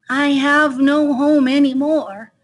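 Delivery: high, slow, plaintive hedgehog; a touch of sadness, words drawn out; mildly sad reply. Acted. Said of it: "Little Hedgehog replied sadly".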